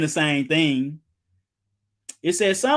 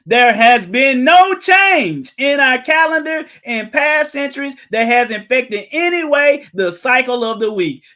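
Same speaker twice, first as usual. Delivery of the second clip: preaching loud; 7 semitones higher, 8 dB louder than usual. Acted. Reading quote at full force.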